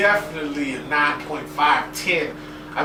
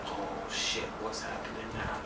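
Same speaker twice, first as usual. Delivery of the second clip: hushed excitement; 7 semitones lower, 14 dB quieter than usual. Retold or imitated. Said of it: "I'm just, like, in the T V".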